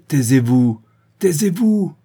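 rising to a high shout; Véronique silencing the woman, shouting; loud order. A narrator's reading. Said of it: "ordonna Véronique".

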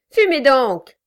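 ordre lancé plus fort; crie-lui de fumer; cri d'ordre. A narrator's reading. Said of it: "cria Quilp". (Quilp crie à Brass de fumer)

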